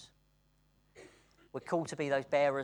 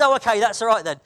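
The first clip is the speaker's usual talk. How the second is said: relieved friend; 7 semitones higher, 15 dB louder than usual. Retold or imitated. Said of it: "He said".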